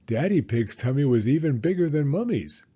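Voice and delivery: usual speaking voice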